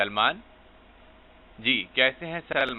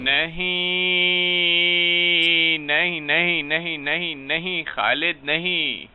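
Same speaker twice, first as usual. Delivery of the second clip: higher, louder, hugely stretched first no; anguished wail of no; 7 semitones higher, 4 dB louder, much slower than usual. Acted. Worried caller protesting to Khalid, drawn-out anguished no. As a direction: wail it out in mock despair.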